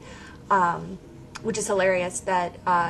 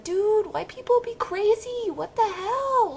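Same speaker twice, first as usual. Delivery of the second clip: much higher, half-screamed outburst; mock yell at the TV; 13 semitones higher than usual. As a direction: yell at the screen in disbelief. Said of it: "scream at the T V".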